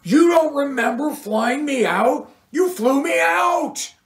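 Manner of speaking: high, furious yell at the rock star; jilted groupie yelling at the rock star who forgot her; angry yell. A narrator's reading. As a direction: shout the betrayal in his face. Cheryl yells at Doug.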